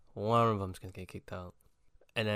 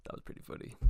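whispered; 18 dB quieter, faster than usual. Shown as a demonstration. Repeated line, whispered.

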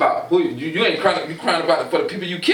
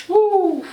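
excited woo; 12 semitones higher, 3 dB louder than usual. Real, not acted. Reacting to hypothetical.

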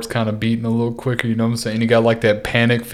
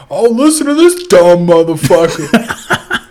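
higher, louder, slow laughing taunt; mock online trash talker; 6 semitones higher, 5 dB louder, slower than usual. Gamers mocking his accent online, loud and laughing. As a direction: jeer like a gamer mocking you. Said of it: "as soon as I say something".

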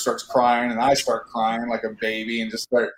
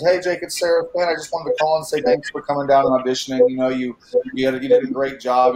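higher, polite caller voice easing down; voiced casting director; 4 semitones higher than usual. Casting director phoning an auditioner, higher polite caller voice. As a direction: lightly imitate a casting director on the phone.

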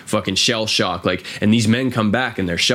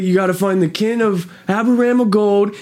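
lightly voiced Tommy, much higher; mock gangster order, raised pitch; retelling the boss's command in a higher put-on voice; imitating Tommy; 10 semitones higher than usual. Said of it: "he's like".

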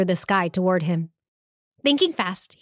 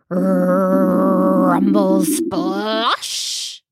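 stomach rumble; much slower than usual. Acted. Fig's stomach interrupts his boast with a long rumble. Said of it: "his stomach reminded him".

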